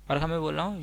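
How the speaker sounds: ordinary talk, nothing marked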